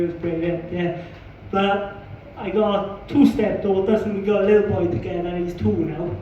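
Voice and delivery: ordinary talk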